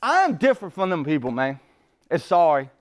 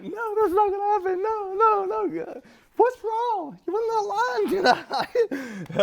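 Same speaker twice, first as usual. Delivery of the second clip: high panicked denial; 14 semitones higher than usual. Retold or imitated. Husband to crying wife on the beach, high frantic pleading. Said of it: "I'm like".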